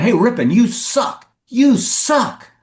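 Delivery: high-pitched mock fan heckling; imitated heckler yell. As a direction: jeer from up in the stands. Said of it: "a knot of fans yelling".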